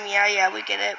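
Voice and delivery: usual speaking voice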